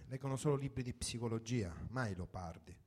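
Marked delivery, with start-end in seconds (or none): none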